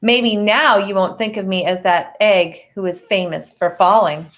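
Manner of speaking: put-on reading a picture book to children; story narration. A narrator's reading. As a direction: tell it gently to the class. Teacher reads picture book aloud.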